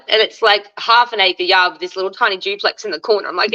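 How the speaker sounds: ordinary talk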